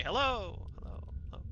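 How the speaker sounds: fading echoed hellos. Acted. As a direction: call hello and let it fade. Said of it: "you guys hear Nyruk say".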